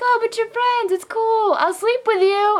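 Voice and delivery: high, wheedling imitation of drunk men; coaxing drunk guy. Drunk guy coaxing the waitress, mock wheedling. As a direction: coax her in a high mock-pleading voice.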